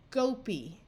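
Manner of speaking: "gopi" ending in a long "ee" sound; final long E. Shown as a demonstration. Teacher trying a nonsense word, "gopi" with final long E. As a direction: slowly read "gopi" as GO-pee. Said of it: "the long E sound will be more common".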